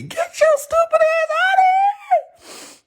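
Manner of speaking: shrieked high-pitched put-down; high mock shout. Comic telling off rappers, high mock shout. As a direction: yell it way up high in mock outrage.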